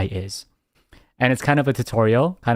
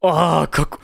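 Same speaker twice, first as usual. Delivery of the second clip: excited; 4 semitones higher, much slower than usual. Real, not acted. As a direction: gush with amazement. Excited fan reaction.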